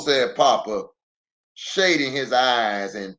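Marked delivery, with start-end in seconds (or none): none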